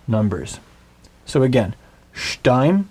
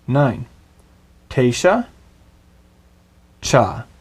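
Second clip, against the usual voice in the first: normal talking voice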